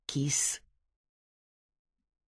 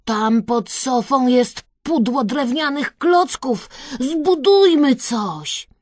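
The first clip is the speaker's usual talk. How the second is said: loud, raised, eager voice; Kresek calling out; 5 semitones higher, 6 dB louder than usual. A narrator's reading. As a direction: pitch the idea eagerly and loudly. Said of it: "zawołał Kresek".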